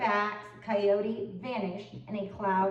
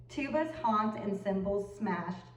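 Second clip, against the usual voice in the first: ordinary delivery, nothing marked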